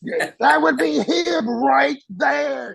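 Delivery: high slow shouted question; screaming. Retold or imitated. Master Chief screaming at the young Marine, high slow yell. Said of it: "you know, just screaming".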